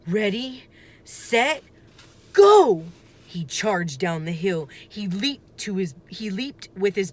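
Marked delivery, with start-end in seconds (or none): 0.1-2.8 building to a loud high go; psyching himself up. A narrator's reading. Boy steeling himself to charge, a building countdown. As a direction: count yourself in, then burst. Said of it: "Jack took a deep breath".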